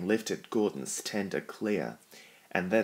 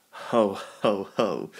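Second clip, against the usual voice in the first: louder booming mock ho ho ho; loud laugh; 5 dB louder, slower than usual. A narrator's reading. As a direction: laugh it up at Thomas's expense. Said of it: "he laughed loudly".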